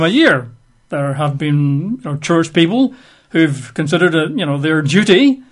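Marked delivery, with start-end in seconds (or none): none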